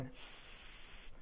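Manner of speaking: long unvoiced s, drawn out; voiceless "s" held long; hissed s sound. Shown as a demonstration. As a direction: hiss the "s" slowly like a snake. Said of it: "the first sound you hear is the word S".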